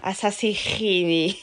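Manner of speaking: lent et traînant, avec l'accent du Sud; étire « rené » à la marseillaise; Marseille accent. Shown as a demonstration. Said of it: "mon expression préférée à Marseille". (accent marseillais traînant)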